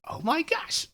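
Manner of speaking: high-pitched mock gushing; excited gushing. Matchmaker when the guy finally gets a date, gushing excitedly.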